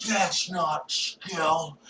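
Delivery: slow, soft mock complaint. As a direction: drag it out quietly, mocking. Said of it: "Did you hear that meme?".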